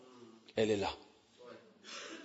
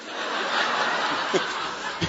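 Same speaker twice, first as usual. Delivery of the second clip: breathy laugh; 6 dB quieter than usual. Real, not acted. Laughter break.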